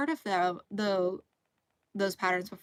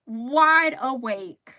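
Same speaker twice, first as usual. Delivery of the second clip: drawn out; 3 semitones higher, 9 dB louder, much slower than usual. Shown as a demonstration. Complaining she can't sleep.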